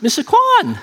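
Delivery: high-pitched comic yelp of pain; squealed protest, hammed up; mock pained plea. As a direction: call out his name in exaggerated agony. Retold plea under painful massage.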